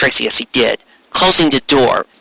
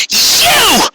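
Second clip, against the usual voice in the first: a shrill, much higher accusing cry; furious exclamation. Acted. Lyra spotting Trixie on the ceiling, shrill furious cry. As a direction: hurl it at her like an accusation.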